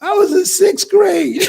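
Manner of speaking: high laughing outburst. Real, not acted. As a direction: crack up as you say it. Laughing at his own story.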